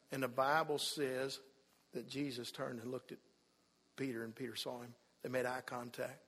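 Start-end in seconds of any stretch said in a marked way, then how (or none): none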